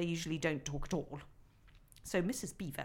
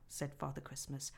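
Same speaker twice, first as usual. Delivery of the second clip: usual speaking voice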